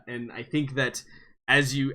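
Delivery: ordinary talk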